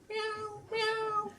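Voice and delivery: high, plaintive imitation meow; high-pitched mock cat call. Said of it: "But it's going".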